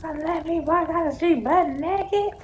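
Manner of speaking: put-on high, scolding mom voice calling out the kids; mother's voice. Mom scolding her kids for staying quiet, high indignant voice.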